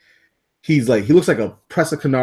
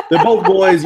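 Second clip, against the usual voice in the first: higher and louder, outraged; indignant outburst; 6 semitones higher, 6 dB louder, slower than usual. Retold or imitated. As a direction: shout it as the shocked owner. Owner repeats in outrage.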